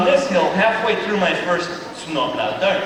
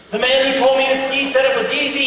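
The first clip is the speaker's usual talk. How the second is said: ordinary talk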